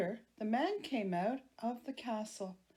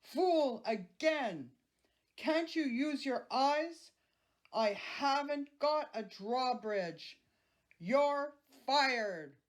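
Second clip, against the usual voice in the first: giant roaring; 5 semitones higher, slower than usual. Acted. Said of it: "roared the giant".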